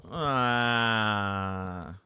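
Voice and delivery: low, drawn-out groan of frustration; long low groan. Real, not acted. Gamer annoyed at his ping, long low groan. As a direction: let out a long, sinking groan.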